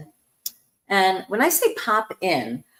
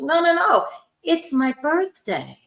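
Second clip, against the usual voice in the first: ordinary talk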